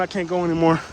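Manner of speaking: ordinary delivery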